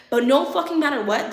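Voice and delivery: usual speaking voice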